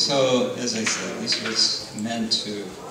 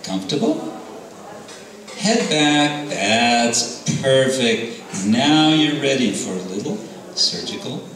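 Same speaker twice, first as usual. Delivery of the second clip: higher, amused and lordly divine voice; a playful god teasing the demon he holds on his lap, pitched up; Nrsingadev's voice; 6 semitones higher, 4 dB louder than usual. Acted.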